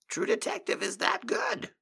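high, hammed-up reverence; mock awe. Exaggerated mock praise.

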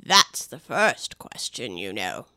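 very low, slow, indifferent drawl; a bored servant, low and slow; slow deep footman. Acted. Footman voice held.

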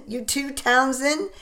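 amused, chuckling through it; laughing. Acted.

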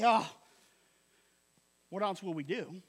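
2 mock resigned. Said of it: "Peter does not say".

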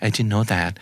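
ordinary talk, nothing marked

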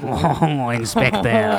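gloating cartoon villain; mustache twirling villain. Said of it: "mustache twirling".

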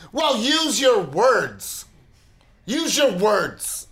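slow, high, sing-song mock of a scolding mom; mocking condescension. Husband mimicking his wife telling him to use his words, slow and sing-song. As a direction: parrot her like a patronizing parent to a toddler. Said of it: "She goes".

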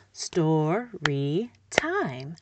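story time split slowly into syllables; syllables clapped out slowly. Shown as a demonstration. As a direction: break story time into slow beats. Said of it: "and I model".

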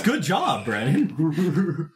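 slow, drawn-out mock congratulations; imitated sarcastic praise. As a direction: mimic a sarcastic "good job", laughing. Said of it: "Now the sarcasm drops".

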